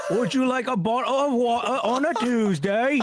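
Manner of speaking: hammed-up 1800s British accent. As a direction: do an Oliver Twist British accent. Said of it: "in a British accent".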